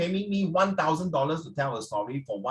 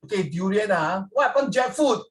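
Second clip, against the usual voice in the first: ordinary talk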